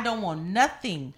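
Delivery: slow, drawn-out, loud "bitch, nothing"; stress on nothing. Real, not acted. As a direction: land it hard and slow. Emphatic climax of rant.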